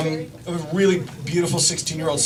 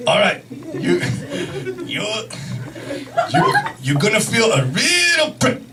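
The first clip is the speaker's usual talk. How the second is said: put-on halting Japanese-accented doctor; mock Japanese accent, words in bursts; imitated Japanese accent; 4 semitones higher than usual. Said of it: "this is how he spoke".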